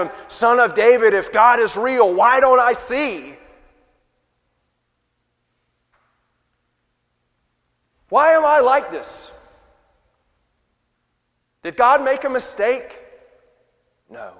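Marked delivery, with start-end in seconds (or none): 0.3-1.1 loud, accusing cry, higher; bitter demand. Acted. Blind men's bitter accusation. 1.1-13.0 loud, high, resentful questioning; bitter complaint. Acted. Blind men's bitter accusation.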